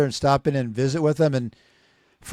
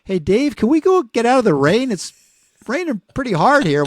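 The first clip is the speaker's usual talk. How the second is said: higher, mock-whiny plea to get out of the rain; mock pleading friends; 8 semitones higher than usual. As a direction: play the soaked friend begging to leave. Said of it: "my brother and my friend go".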